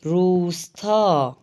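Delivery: روستا drawn out slowly, rolled ر at the start; slow model word. Shown as a demonstration. Teacher shows how to read روستا with ر slowly. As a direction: carefully sound out روستا as rus-ta. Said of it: "رے والے الفاظ کو ہم کیسے پڑھیں گے".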